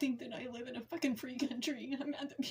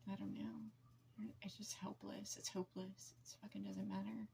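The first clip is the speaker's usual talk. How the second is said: despairing whisper; 3 semitones lower, 9 dB quieter than usual. Real, not acted. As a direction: let the voice give out into a whisper. Despairing over abuse, voice collapsing.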